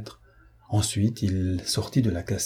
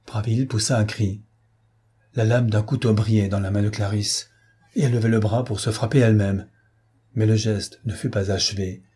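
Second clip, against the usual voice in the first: ordinary delivery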